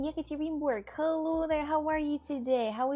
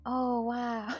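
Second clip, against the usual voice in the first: usual speaking voice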